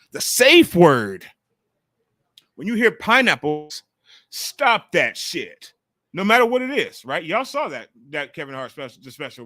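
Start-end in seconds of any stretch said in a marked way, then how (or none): 0.1-1.3 slow, loud drawn-out echo; slow loud emphatic repeat; mock safe-word call. Echoing Kevin Hart's line. 2.5-5.7 loud, slow comic command; Kevin Hart bit imitation.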